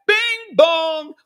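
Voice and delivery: high loud doorbell ding-dong; bing bong chime. Pretend. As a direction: sing it as a chime. Covid chime gag repeated.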